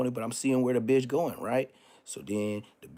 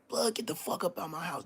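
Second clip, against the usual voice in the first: higher, mock-tough order out of the house; imitating ordering the ghost out, pitched up; a touch of a raised retold threat; retold command; 7 semitones higher, 3 dB quieter than usual. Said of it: "that's exactly what I said".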